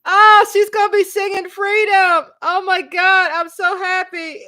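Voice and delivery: high, loud squeal of real joy; excited screaming. Real, not acted. Beyonce fan hears of the song, excited screaming. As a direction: shout it out, overjoyed. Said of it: "The reason why I'm screaming".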